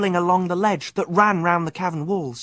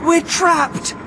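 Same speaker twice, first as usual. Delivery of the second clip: gasped; 11 semitones higher than usual. Acted. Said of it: "gasped Mouse".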